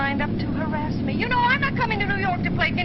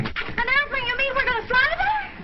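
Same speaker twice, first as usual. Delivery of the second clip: high, louder astonished questions; thrilled surprise; 7 semitones higher, 3 dB louder than usual. Acted. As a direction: squeal with amazed delight.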